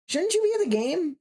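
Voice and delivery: high mocking sneer; squeaky taunting question.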